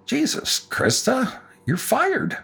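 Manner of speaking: high, softened disbelief; acted lightly, as if lost for words; stunned boss. Stunned dentist firing employee after she swore at him.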